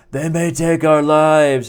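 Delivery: slow, loud, mock heroic Braveheart shout; rally the troops, drawn out and loud; Mel Gibson battle cry. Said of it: "Mel Gibson's like".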